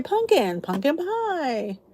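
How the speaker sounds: pumpkin as "punkin", without the middle P; casual pronunciation. Shown as a demonstration. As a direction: drop the P in pumpkin, drawing out pie. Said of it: "But I say pumpkin".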